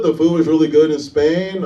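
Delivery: ordinary talk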